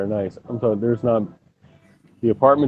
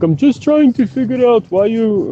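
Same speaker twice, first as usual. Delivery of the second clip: bad, high Jamaican accent, louder; mock Jamaican voice, pitched way up; 12 semitones higher, 5 dB louder than usual. Mocking the Jamaican guy in a bad Jamaican accent. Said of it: "the worst Jamaican accent ever".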